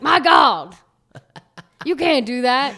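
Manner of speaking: announcer yelling at laundry; mock commentator. Commentator over mixed laundry, mock outraged call. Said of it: "It's like".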